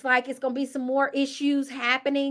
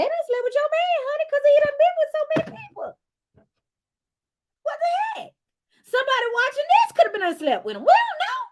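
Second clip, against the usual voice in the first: high excited rant; 13 semitones higher, faster than usual. Real, not acted. Vlogger gushing to viewers, high excited squeal. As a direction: pitch shoots way up, fast and gushing.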